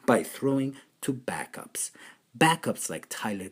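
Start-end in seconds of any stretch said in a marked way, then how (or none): 0.1-1.9 whispering. Acted. Punchline answer.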